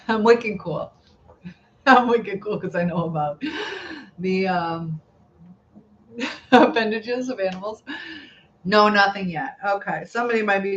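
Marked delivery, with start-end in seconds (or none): 0.1-7.8 laughing. Real, not acted. Laughing at herself.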